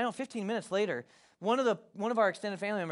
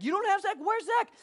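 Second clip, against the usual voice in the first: high-pitched frantic phone call, acted out; imitated panic; 12 semitones higher, 3 dB louder than usual. Relatives phoning each other about missing Zach, imitated panic. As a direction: re-enact alarm leaping up at bad news. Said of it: "So everybody's panicky".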